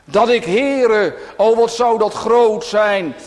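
high, loud, impassioned cry; full-force revival preaching; fervent preaching. Real, not acted. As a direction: lift the voice and plead from the pulpit.

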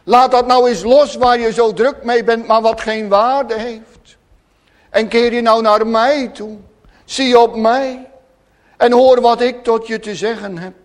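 loud, impassioned pulpit voice; fervent preaching. Acted. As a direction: preach it out with full conviction. Preacher in full flow. (vurig preken, luid en hartstochtelijk)

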